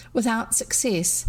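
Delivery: plain reading voice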